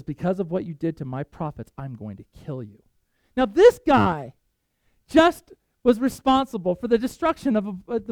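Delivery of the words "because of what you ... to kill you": low threat, dropping very soft; acted quiet threat. Jezebel threatens Elijah over her prophets, quiet acted menace. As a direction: let the menace sink lower and quieter.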